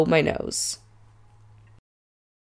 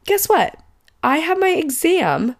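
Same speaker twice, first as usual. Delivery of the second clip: much higher, exasperated; indignant outburst; 9 semitones higher than usual. Real, not acted. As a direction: shoot up in disbelief. Outraged reveal.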